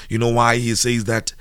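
ordinary talk

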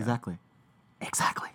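a breathy whispered exactly, trailing off; whispered agreement. Real, not acted. Co-host trailing off into a whispered agreement. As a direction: let the agreement fade to a whisper.

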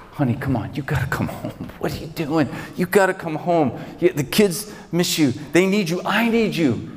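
coaxing that builds to high-pitched begging; pleading. Acted. Husband begging wife in marketplace to come home, pleading. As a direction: plead harder with each phrase, pitch climbing.

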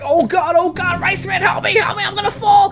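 high-pitched panicked cries for help; hammed-up frantic pleading; acted panic. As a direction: play terrified of falling, voice climbing. Acted panic, hanging from flying car.

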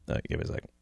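gathering himself, barely voiced; composing himself. Real, not acted. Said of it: "I'm fucking welling up".

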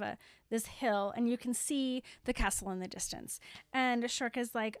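ordinary talk, nothing marked

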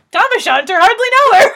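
loud, high, fast joking shout; shouted punchline. Pretend. Cracking a pun for the co-host, mock-rowdy shout. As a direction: blurt the gag at full volume.

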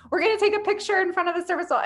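high, rushed imitation of eager guests; excited guests. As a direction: gush it quickly as a thrilled customer. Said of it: "They were, like, so excited".

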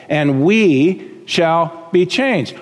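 "we" stretched out slowly before "shall be changed"; stress on WE. Real, not acted. Preacher ending the verse for listeners, stressing "we". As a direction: linger on "we", then finish deliberately. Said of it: "Why do I keep emphasizing the word".

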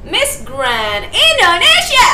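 high, very slow pageant sing-song; pageant announcer. Shown as a demonstration. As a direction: announce yourself like Miss Grand. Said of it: "Nggak pakai dua puluh tujuh tahun".

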